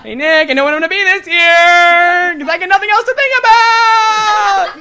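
high, loud squealed excitement; mock overexcited announcement; too-positive coworker. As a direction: shout it with hammed-up glee. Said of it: "She's usually the same person".

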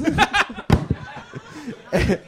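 breathy laugh. Real, not acted. Guest laughing at own plug.

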